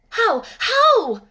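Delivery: high, loud eager cry. Acted. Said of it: "cried Mary, forgetting to be careful".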